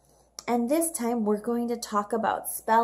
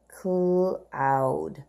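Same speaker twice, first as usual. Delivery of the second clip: cloud stretched long and low; slow model read; 5 semitones lower than usual. Shown as a demonstration. Teacher modelling cloud slowly for the class. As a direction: draw out cloud for young readers. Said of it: "Let's read the words together".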